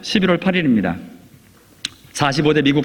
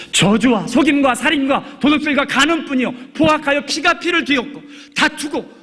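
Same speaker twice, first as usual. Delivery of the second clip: raised, ringing preacher's voice, much higher; fervent preaching; 12 semitones higher than usual. Acted. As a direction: drive the point home from the pulpit. Preaching at full force.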